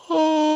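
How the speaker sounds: high, drawn-out "Oh" of relief; relieved sigh. Retold or imitated. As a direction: let it out like sweet relief. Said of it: "it was like".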